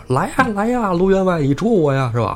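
high sing-song teasing call; playful taunt. Retold or imitated. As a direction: goad the pursuer to come and catch you. Fleeing bandit taunts his pursuer.

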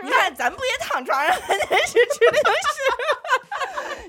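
slow, high, laughing mock of the husband; laughing imitation. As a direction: imitate him slowly through laughter. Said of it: "一边吃一边".

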